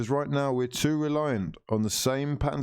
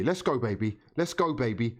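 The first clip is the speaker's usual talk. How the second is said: ordinary delivery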